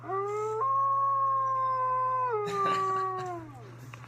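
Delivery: long high wolf howl. Acted. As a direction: throw your head back and howl like a wolf. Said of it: "We're gonna have to do our Wolf Howl".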